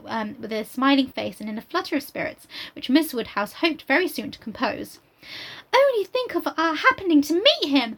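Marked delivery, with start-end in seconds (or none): 5.8-8.0 put-on higher, breathless gushing girl; eager Harriet. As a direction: gush it out like an excited young girl. Reads Harriet's lines aloud.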